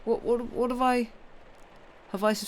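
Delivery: ordinary talk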